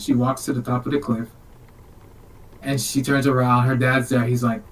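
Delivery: ordinary talk, nothing marked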